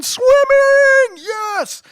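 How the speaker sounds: high, loud shout with long-held vowels; excited little kid. Retold or imitated. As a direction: imitate a kid whooping with joy. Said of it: "He's looking at it and he's going".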